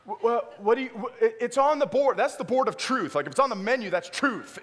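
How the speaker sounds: hammed-up shock at the counter; stunned indignation. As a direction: sputter in disbelief at the cashier. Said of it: "I was like".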